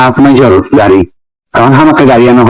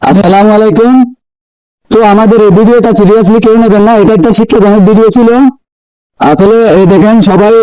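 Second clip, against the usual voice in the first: raised, standard Bengali sign-off to viewers; outro address; 6 semitones higher than usual. Real, not acted. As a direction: speak up to the audience, standard Bengali. Closing message to viewers.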